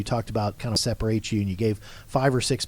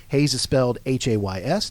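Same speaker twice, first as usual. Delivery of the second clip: ordinary talk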